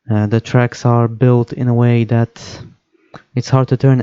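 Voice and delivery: ordinary talk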